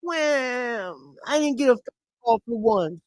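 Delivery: high, whining mock of the coach complaining; mocking a complaining coach in a high whine; whiny coach. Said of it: "he got teed up for crying".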